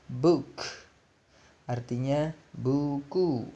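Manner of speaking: ordinary talk, nothing marked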